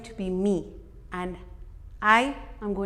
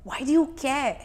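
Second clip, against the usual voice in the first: higher-pitched, challenging reproach; inner critic; 5 semitones higher, 3 dB louder than usual. Acted. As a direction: throw it out as an accusing question. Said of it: "as your inner critical voice".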